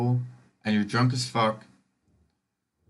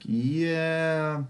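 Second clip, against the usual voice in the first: long, higher-pitched drawn-out yeah; mock hesitant agreement; 5 semitones higher than usual. Answering someone who guessed Swedish, drawn-out hesitant yeah. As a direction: stretch it out, reluctant to correct them.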